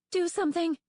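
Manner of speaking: high, loud frightened cry; frightened dragonet pleading, high and loud; panicked plea. A narrator's reading. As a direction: beg for help in a panic. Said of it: "in a panicked rush".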